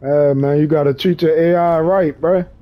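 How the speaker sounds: ordinary talk, nothing marked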